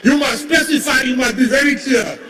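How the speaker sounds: normal talking voice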